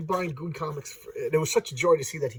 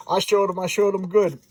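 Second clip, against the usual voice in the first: higher, louder hillbilly gloat; gloating possum; 4 semitones higher, 6 dB louder than usual. Acted. Possum boasts of showing him, gloating. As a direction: crow over your trick.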